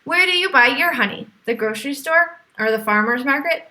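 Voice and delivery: ordinary delivery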